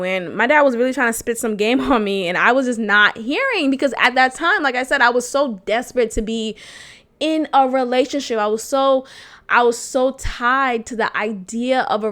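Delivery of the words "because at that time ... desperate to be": ordinary delivery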